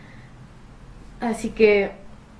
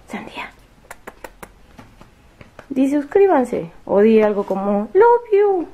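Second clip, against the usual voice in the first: cheerful cooing, much higher; pet-talk coaxing; 5 semitones higher, 3 dB louder than usual. Real, not acted. Owner begs Sandía to say subscribe, high excited baby talk. Coaxes Sandía to speak, kiss.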